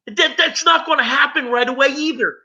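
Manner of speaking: high-pitched, emphatic warning to newcomers; impassioned recovery preaching. Real, not acted. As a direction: drive the hard truth home, insistently. Exhorts audience directly.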